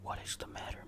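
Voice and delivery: usual speaking voice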